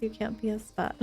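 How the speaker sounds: ordinary delivery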